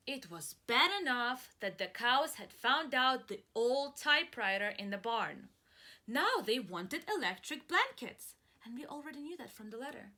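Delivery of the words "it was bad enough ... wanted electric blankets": louder, slowish story narration; storytelling. Acted. Teacher narrating the farmer's troubles, exasperated storytelling. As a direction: tell it with mounting exasperation.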